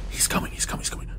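urgent terrified whisper; breathless hushed warning. Real, not acted. Hiding as Ronald approaches.

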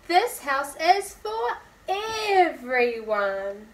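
slow, loud declaration; group shout. Acted. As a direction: call it out together, word by word. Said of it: "shouted Lindy".

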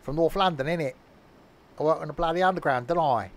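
hammed-up North London geezer accent; badly faked North London voice; attempted North London accent. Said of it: "I can't do a North London accent".

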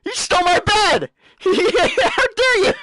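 laughing through a mock-outraged shout; mock outraged mob. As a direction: ham up an offended game creature storming in. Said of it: "he's like".

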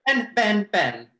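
loud quick call of a name. Real, not acted. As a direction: shout the name to pass the turn.

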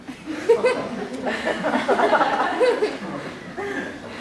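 high, real laugh breaking out; burst of laughter. Real, not acted. Laughing at brother's coming out.

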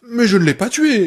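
loud protest, pitch up; shouting. Acted. Paul yelling his denial at Annie. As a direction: yell the denial in pain. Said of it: "hurla-t-il".

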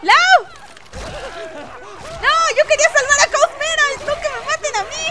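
high, loud panicky yelling; panicked shouts. Real, not acted. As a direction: yell for help in a panic. Panicking as character is attacked.

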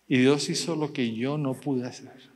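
ordinary talk

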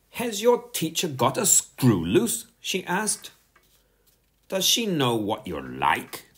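disbelieving big-sister jibe; incredulous sister. A narrator's reading. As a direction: ask it as a scandalised sister. Sister mocks brother in disbelief.

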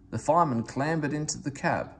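plain reading voice

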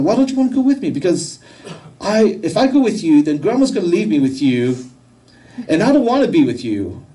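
high, loud, voice straining with emotion; choked up. Real, not acted. Father retelling his daughter's rejection, choked up. Painful memory, voice climbs tearfully.

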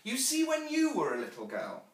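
put-on high-pitched little girl prompting her mother; inquisitive child. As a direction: pipe up like a curious child. Child Jane questions her mother.

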